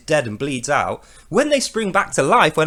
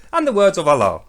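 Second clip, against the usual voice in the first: usual speaking voice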